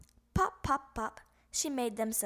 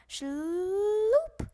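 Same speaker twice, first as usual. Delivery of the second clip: long rising shloop noise; dragging shloop; 8 semitones higher, 6 dB louder than usual. A narrator's reading. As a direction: drag the sound out. Sack dragging sound.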